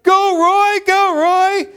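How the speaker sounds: very high, loud sideline cheer; cheering shout. Retold or imitated. Other team urges on the wrong-way runner, cheering shout. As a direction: shout it like a rival team egging him on. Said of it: "The other team is like".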